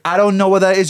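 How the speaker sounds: high, loud mock bewilderment; baffled American waiter. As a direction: play a server who is totally lost. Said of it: "they'll go".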